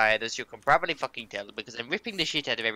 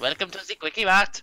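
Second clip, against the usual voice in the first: put-on Quickie Mart clerk accent, higher; Indian shopkeeper accent; 5 semitones higher than usual. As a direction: greet like a corner-shop clerk. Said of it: "German Indian accent".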